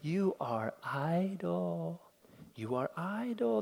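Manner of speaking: slow, soft, smirking contempt; dismissive sneer. Acted. Pharaoh dismissing the foremen, slow quiet sneer. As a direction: say it softly, with a dismissive smile. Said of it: "perhaps with a dismissive smile".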